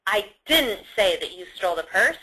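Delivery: "didn't" hit firmly as an absolute denial; stress on DIDN'T. Shown as a demonstration. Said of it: "It's like an absolute no".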